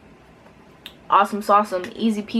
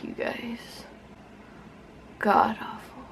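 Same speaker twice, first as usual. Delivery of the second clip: whispering; 9 dB quieter, slower than usual. Real, not acted. Judging bad result.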